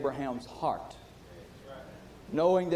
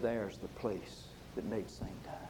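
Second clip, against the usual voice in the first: quiet; 6 semitones lower, 8 dB quieter than usual. Retold or imitated. Pilot's quiet, solemn reply.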